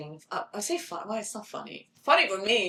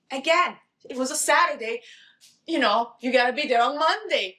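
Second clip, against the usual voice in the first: raised, loud retold insistence; urgent agent; 6 semitones higher, 6 dB louder than usual. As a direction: press the point like an agent. Retold agent's urgent booking call.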